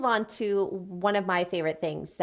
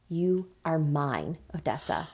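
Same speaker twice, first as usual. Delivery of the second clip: low, slow, possessive claim; understated growl; 5 semitones lower, slower than usual. A narrator's reading. Hero claiming the woman he loves, understated slow growl. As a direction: lightly growl it, drawing out each word. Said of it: "It was a growl, more animal than human".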